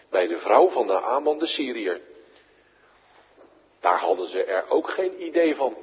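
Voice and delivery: ordinary delivery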